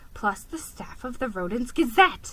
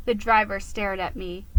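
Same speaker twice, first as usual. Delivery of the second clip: ordinary delivery, nothing marked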